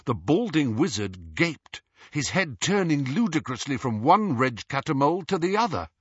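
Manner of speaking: ordinary delivery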